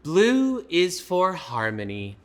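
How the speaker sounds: loud, slow read-aloud; reading to children. Pretend. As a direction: lift the line for young listeners.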